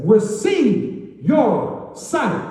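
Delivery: very slow, high, drawn-out command; loud, drawn-out proclamation; solemn command. Acted. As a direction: stretch every word as a healing command. Jesus heals blind Bartimaeus.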